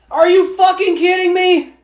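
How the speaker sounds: loud, high, disbelieving yell; furious outburst. Real, not acted. Fan yelling at his team's fumble, furious disbelief.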